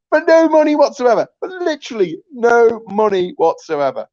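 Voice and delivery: high, loud frustrated outburst; exasperated rant. Real, not acted. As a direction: hammer home the no money. Ranting about Labour.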